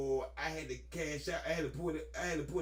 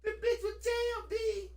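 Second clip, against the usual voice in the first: high outraged complaint; 14 semitones higher, slower than usual. Retold or imitated. Retelling jammed parachute, outraged.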